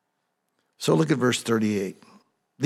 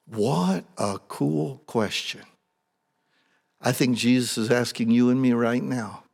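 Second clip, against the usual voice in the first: usual speaking voice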